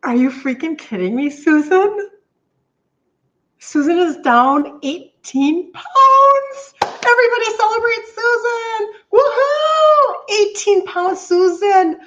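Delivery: high, loud celebratory shout with a laugh; excited cheering. Real, not acted. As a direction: cheer her on like she just won. Celebrating a viewer's weight loss.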